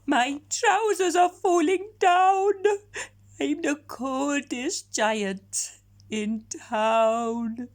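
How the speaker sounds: high, slow, tearful wail; a giant near tears, voice cracking; crying giant. Acted. Giant wails that his trousers fall down, crying. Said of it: "felt sad and shivery".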